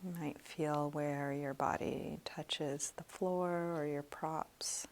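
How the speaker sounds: soft, slow meditation voice; guided meditation. Real, not acted. Meditation teacher leading students through a body scan, soft and slow. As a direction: guide them gently through a body scan, leaving long pauses.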